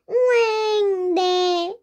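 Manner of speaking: 길게 늘여 우는소리로 마지못해 대답; mock wail, drawn way out. 엄마에게 돈을 뺏기는 장면. (우는소리로 길게)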